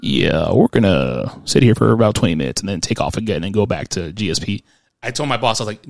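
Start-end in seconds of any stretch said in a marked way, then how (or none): 0.0-4.6 low-pitched pilot intercom voice; lowered, unbothered cockpit voice; airline captain. Retold or imitated. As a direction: parody a captain's casual announcement. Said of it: "the pilot's like".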